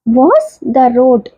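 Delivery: very slow, word-by-word example read; slow classroom reading of an English example; slow dictation. Shown as a demonstration. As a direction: spell the sentence out slowly for students. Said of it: "teacher dictating example sentence".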